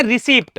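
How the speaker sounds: रिसिप्ट with the p sounded; wrong pronunciation. Shown as a demonstration. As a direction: voice p before the final t in रिसिप्ट. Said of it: "गलत ही बोलते हैं". (रिसिप्ट में 'प' बोलकर गलत उच्चारण)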